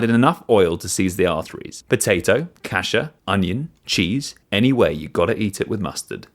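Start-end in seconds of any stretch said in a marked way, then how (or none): none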